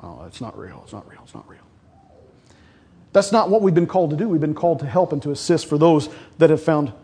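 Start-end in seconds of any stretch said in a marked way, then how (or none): 0.0-1.6 low, soft, muttered dismissal; low muttering mock gossip; gossiping church people. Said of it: "so we say things".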